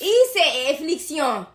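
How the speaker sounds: slow, hammed-up French accent; deliberately overdone mock-French accent. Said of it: "attempt to do the French accent".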